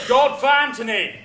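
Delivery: demonstrated battle cry. Shown as a demonstration. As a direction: proclaim it loudly as a war cry. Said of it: "I think they should shout".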